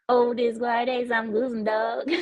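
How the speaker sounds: second go at the Southern drawl, laughing through it. Pretend. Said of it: "Wait, here we go".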